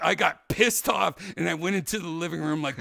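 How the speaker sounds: ordinary delivery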